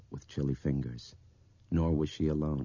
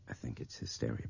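low, soft confidential voice; hushed, near whisper; 6 semitones lower, 8 dB quieter, faster than usual. A narrator's reading. Brian confiding in Nick, low and hushed. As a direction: murmur it close to his ear. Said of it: "He was whispering now".

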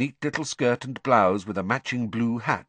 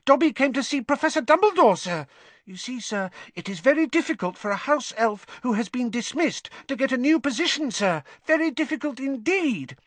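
high, squeaky elf voice; earnest house elf; 14 semitones higher than usual. A narrator's reading. Dobby explaining to Harry why elves struggle, high and squeaky. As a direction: explain it eagerly in a tiny high voice.

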